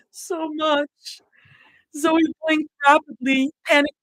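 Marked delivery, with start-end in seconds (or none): 0.1-1.2 high, breaking voice. Acted. Mira breaks down telling her friends she loves them, voice cracking. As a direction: let the voice crack on it. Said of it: "Her voice broke".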